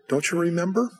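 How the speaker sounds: high, rising reminder to a friend; earnest prompting. Retold or imitated. Preacher asks old friend at the reunion, earnest prompting. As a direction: gently press the question upward.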